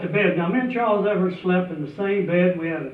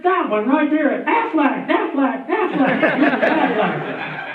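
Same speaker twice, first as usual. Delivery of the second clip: high, louder quacking "Aflac" like the ad duck; 6 semitones higher, 4 dB louder, slower than usual. Retold or imitated. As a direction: honk out "Aflac" like the insurance duck. Goose honks like Aflac duck.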